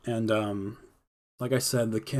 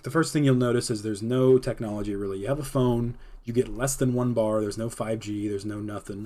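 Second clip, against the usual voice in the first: ordinary talk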